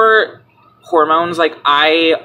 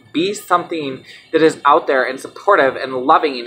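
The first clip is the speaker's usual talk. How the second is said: usual speaking voice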